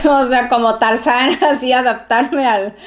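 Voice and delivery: giggling while picturing living like Tarzan; laughing. Real, not acted. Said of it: "Yo, yo riéndome".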